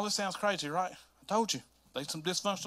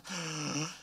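sharp, quiet gasp of shock; a startled intake of breath; 9 dB quieter than usual. Acted. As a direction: gasp in horror at the idea. Shocked at the thought.

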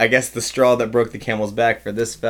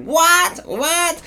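very high bewildered what, twice; confused old lady. Retold or imitated. Old lady confused by the walker fuss. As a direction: squeak it out, lost and confused.

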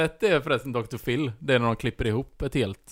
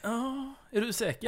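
much higher, incredulous "are you sure"; mock doubtful; 11 semitones higher, 7 dB quieter, slower than usual. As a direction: play the caught-out psychic stalling in disbelief. Medium doubting, high pitched. (spelat tvivlande, mycket ljusare röst)

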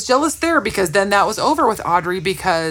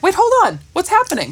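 high, startled double take; confused surprise; 10 semitones higher, faster than usual. Retold or imitated. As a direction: stop short in confusion. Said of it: "I was like".

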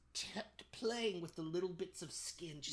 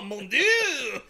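mock French; 10 semitones higher, 14 dB louder than usual. Joking about French film.

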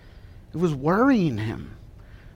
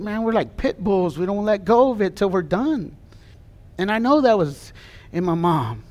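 usual speaking voice

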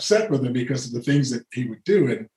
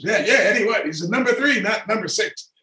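imitating father's raised voice; 9 semitones higher, 4 dB louder than usual. Father scolding Porterfield at parade.

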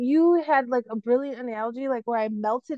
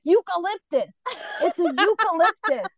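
louder, higher, spelling out "Eucalyptus"; correcting; 5 semitones higher, 4 dB louder than usual. Retold or imitated. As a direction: hit "Eucalyptus" clearly like a correction. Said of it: "I was like".

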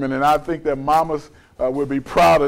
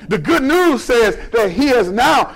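raised, emphatic pulpit voice; forceful preaching; 5 semitones higher, 3 dB louder, slower than usual. Real, not acted. Preacher proclaims the good news to the church, forceful. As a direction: preach it loud with drawn-out key words.

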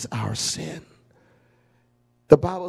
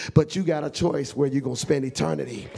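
ordinary delivery

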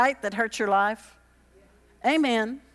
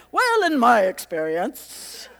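smug lean-back voice; slower than usual. Retold or imitated. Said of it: "kicking back in my chair and saying".

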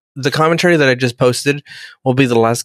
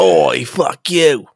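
drawn-out mock Cockney Klingon; slow, stretched Cockney swearing; Cockney accent; much slower than usual. Klingon imagined by Guy Ritchie swearing, in slow Cockney. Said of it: "Cockney accents and stuff".

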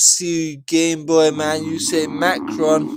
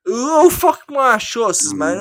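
mock outburst; 9 semitones higher, 7 dB louder than usual. Mock outrage at friend.

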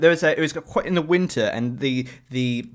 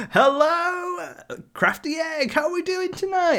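high, hammed-up crowd-work voice; cheesy cafe performer; slower than usual. Host mimics the cafe singer greeting the crowd, high and cheesy. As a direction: greet an empty room like a stadium. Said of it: "it's like".

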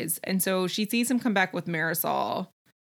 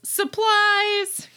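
high, loud burst imitating a yell; mock yell; 12 semitones higher, 6 dB louder than usual. Casey bursts into the house with the joke, imitated yell. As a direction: holler it like a botched surprise. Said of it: "and yells".

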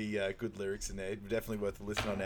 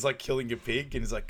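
ordinary talk

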